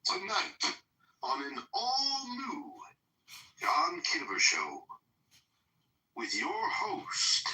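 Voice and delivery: slow, dramatic TV announcer. Pretend. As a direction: announce it like a show trailer. Mock TV show introduction.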